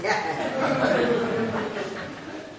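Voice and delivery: warm laugh at his own joke; real laugh. Real, not acted. Storyteller laughing at his own ending, real laugh. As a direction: laugh along after the punchline.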